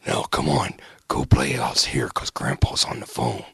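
low, slow hushed whisper. Retold or imitated. Wrestler hushing kids, retold.

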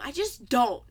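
loud, curt brush-off; blunt dismissal. Retold or imitated. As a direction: shut it down flatly and loudly. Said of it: "I just need that to be a meme".

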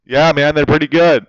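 very loud, chill bro; loud dude voice. Pretend. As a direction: boom it like a laid-back stoner. Acted dude dialogue.

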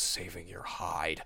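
hint of a stoic hero, words drawn out; slow, understated superhero retort; Batman. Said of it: "And Batman goes".

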